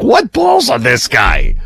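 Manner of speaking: slow, drawn-out mock amazement; mimicked amazed outburst. Curator imitating the host at the letter display, amazed outburst. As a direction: imitate someone blurting it in disbelief, stretched out. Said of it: "you're standing here and you're like".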